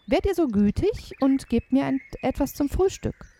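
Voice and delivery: a hint of a hungry boy; polite boy. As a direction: beg for breakfast politely. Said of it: "so höflich er kann".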